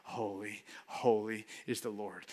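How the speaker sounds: a secret murmur that the boss must not hear; under your breath. Shown as a demonstration. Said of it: "you would say under your breath".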